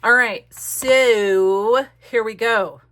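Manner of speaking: loud, slow drumroll announcement; excited reveal. Real, not acted. Crafter unveiling her finished page, loud and slow.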